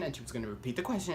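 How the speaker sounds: usual speaking voice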